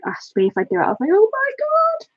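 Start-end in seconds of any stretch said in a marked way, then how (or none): 1.1-2.1 retold ecstatic squeal. Streamer retells being picked for colouring group, ecstatic squeal. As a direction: re-enact squealing with joy. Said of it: "absolutely jumping up and down".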